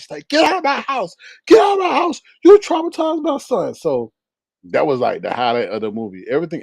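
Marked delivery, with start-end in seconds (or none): 0.3-3.7 much higher, louder, half-crying order to leave; mock furious yell. The boy's mother screaming at her sister to leave, in a mock furious, crying yell. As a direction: throw her out of the house, near tears. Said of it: "her sister was mad".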